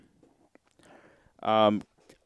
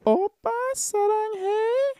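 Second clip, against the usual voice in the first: very high, slow, sugary mock girl voice; cutesy girlfriend voice; slower than usual. Vlogger mocking a cutesy girlfriend, very high mock coo.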